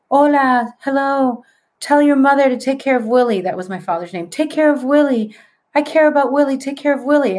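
a ghostly woman pressing a message about Willie; spirit woman's plea. Retold or imitated. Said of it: "And she's like".

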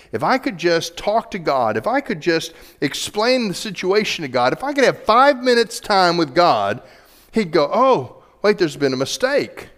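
high, loud, mounting appeal; protesting Job. Retold or imitated. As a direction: act out Job insistently pleading his case. Job protesting his case, acted out.

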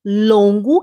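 slow, drawn-out explanation; deliberate classroom pace; slow teaching. Shown as a demonstration. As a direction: lay it out slowly for students. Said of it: "duas maneiras de você falar".

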